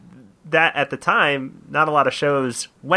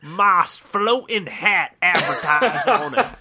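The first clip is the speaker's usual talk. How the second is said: slow, laughing Southern drawl; Southern accent; 4 semitones higher, much slower than usual. Retold or imitated. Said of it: "Southern accent bit".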